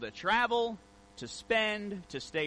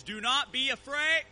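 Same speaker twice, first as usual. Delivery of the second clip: loud, high booming message; recorded voice at full volume; voice blaring from car speakers; 7 semitones higher, 4 dB louder than usual. Retold or imitated. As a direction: blast it like maxed-out speakers. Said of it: "I hear my voice".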